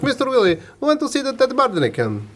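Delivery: put-on high, heavily accented Polish builder. Polish builder telling his client about a find, in a mock Polish accent. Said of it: "to do the accent".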